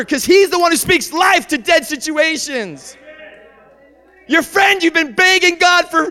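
high, loud preaching shout. Real, not acted. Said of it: "Forgive me for shouting".

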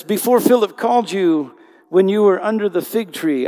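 loud, high proclaiming voice of Jesus; preaching at full force. Acted. Preacher booming Jesus' words to the congregation, loud and high. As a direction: lift the voice and proclaim it.

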